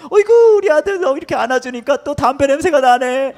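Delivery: very high, cooing praise; hammed-up loving squeal; gushing doting father. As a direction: coo over the son with over-the-top delight. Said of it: "목사님이 아들을 맨날 맨날 사랑해 준 거예요".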